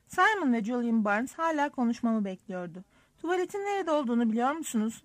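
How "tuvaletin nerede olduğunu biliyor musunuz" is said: fast, higher-pitched question; rushed, flustered question; sudden blurt. A narrator's reading. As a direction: blurt it out all at once. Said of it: "dedim birden".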